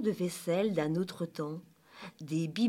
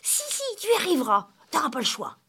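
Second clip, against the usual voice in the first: high, rapid, forceful urging; urgent high-pitched insistence; insistent; 7 semitones higher, 5 dB louder, much faster than usual. A narrator's reading. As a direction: overrule him quickly and firmly. Émilie insists Léo must run.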